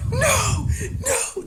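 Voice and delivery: very high, loud squealed protest; hammed-up tearful shriek; mock crying. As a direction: wail it like a sobbing friend. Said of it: "just crying".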